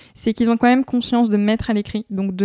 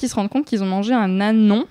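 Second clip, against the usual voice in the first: ordinary talk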